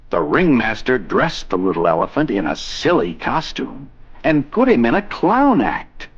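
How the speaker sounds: usual speaking voice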